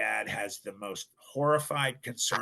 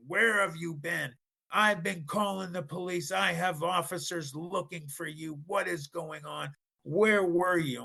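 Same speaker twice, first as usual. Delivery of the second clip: imitating an angry dad, higher pitched; mock screaming dad; 8 semitones higher than usual. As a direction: scold like a frantic father, short of screaming. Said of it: "His dad starts screaming at him".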